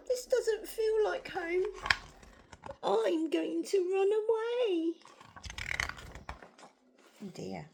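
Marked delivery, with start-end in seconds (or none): 0.1-4.9 high, slow storybook wail; woeful little dinosaur, drawn out; crying. A narrator's reading. Narrator voices unhappy Drip planning to run away, crying. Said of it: "And he cried".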